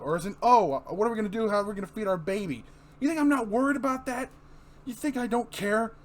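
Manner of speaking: high, loud, upset; desperate husband yelling at wife; anguished outburst. Acted. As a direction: lash out from worry.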